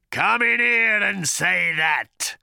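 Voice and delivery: high, loud, slow apoplectic howl. A narrator's reading. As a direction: howl in a sudden fit of rage. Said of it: "howled the voice in a sudden apoplexy".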